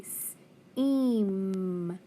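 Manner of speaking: slowly stretched S-eam, split into sounds; stretched sounding out. Shown as a demonstration. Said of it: "teacher sounds out seam".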